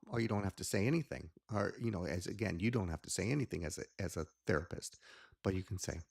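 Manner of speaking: usual speaking voice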